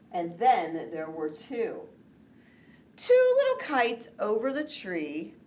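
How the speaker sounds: put-on slow, lilting rhyme for children; poem recital. Teacher reciting the kite poem to the class, lilting.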